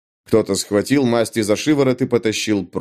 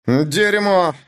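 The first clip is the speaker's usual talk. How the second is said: angry curse; 6 semitones higher than usual. Acted. Bandit curses from the street.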